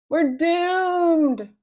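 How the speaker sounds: put-on boy crying out in despair. A narrator's reading. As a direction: wail that all is lost. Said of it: "cried Harold".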